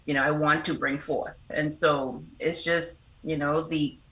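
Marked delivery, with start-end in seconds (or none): none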